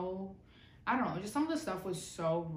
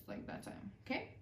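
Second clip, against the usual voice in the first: whispered; 2 semitones higher, 8 dB quieter than usual. Retold or imitated. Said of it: "I was like this".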